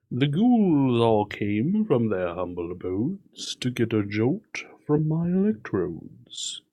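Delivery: slow, swooping horror-host delivery; spooky lyric recital. Acted. Co-host reciting Monster Mash lyrics, spooky voice. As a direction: recite it slowly like a campy ghoul.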